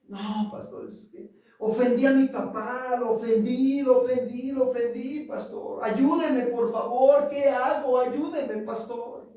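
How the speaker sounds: imitating a sobbing youth crying for help; retold tearful plea. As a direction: plead with the pastor like a broken young man. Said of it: "llegó llorando, quebrantado".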